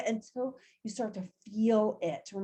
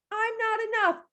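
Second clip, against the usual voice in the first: high, whiny self-doubt voice; mock the little voice in your head; imitating a nagging inner voice, pitched up; mock inner critic; 9 semitones higher than usual. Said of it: "tells you".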